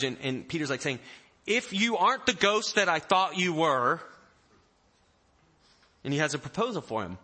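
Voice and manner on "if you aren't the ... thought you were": higher, louder, testing Peter; bold challenge. Acted. Peter calling to Jesus on the water, bold challenge. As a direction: call it out as a dare.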